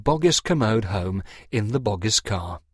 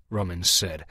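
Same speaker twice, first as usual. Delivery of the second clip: usual speaking voice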